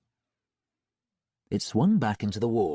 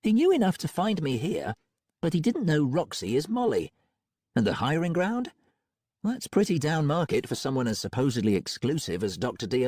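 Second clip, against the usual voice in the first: put-on higher, thoughtful young woman; Molly's own voice; 6 semitones higher than usual. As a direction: muse it aloud as Molly. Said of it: "in her own voice".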